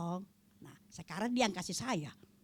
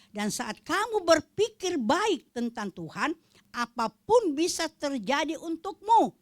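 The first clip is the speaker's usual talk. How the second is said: slow, building, louder preaching; slow sermon swelling to louder fervor; 4 semitones higher, 7 dB louder, slower than usual. Acted. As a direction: rise to a fervent climax.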